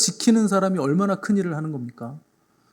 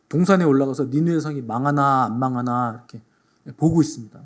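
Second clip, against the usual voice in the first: normal talking voice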